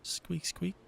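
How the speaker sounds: mouse squeaks. Acted. Hinting at the mice behind the wall, squeaky mouse voice.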